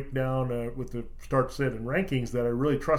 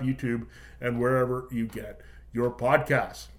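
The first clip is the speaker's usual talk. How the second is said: promo read; 3 semitones higher, 5 dB louder than usual. Acted.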